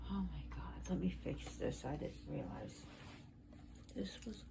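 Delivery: under the breath, distracted; hushed aside. Real, not acted. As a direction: drop to a barely audible private aside. Aside while fixing something.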